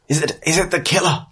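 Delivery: high, halting anxious question; alarmed townsperson. Acted. Frightened townsperson asking about the killer, alarmed. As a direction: blurt it out in high-pitched alarm. Frightened crowd member interrupts.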